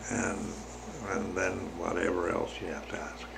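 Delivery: soft, low-pitched trailing explanation; talking low. Real, not acted. Telling listeners to ask whatever else, talking low. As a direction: say it quietly, down low. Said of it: "am I talking too low?".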